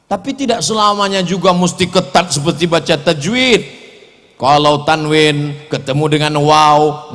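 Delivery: ordinary delivery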